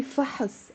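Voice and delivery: فحص with a short vowel slipped in, fa-hus; easier helping vowel. Shown as a demonstration. Teacher offering the easier way, with an added short vowel. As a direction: say فحص with a light uh before the s. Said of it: "But if it's easier, say".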